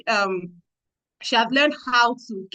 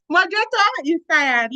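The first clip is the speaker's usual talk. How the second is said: laughing; 7 semitones higher, 4 dB louder than usual. Real, not acted.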